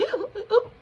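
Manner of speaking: high, loud gasps for air; imitated gasping. Showing viewers her breathless panic, imitated high gasping. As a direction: mimic failing to catch your breath. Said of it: "trying to catch my breath, and I can't".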